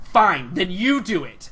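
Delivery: loud, slow furious shout; angry outburst. Acted. Seamstress losing patience with the girl in her way, a loud slow angry shout. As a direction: fling the words at her in fury.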